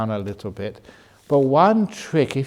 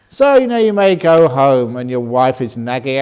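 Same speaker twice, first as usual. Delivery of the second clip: ordinary talk, nothing marked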